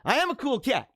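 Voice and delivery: ordinary talk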